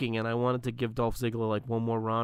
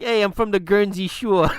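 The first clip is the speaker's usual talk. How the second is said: mock local boast; 8 semitones higher, 11 dB louder than usual. Mocking a Guernsey local.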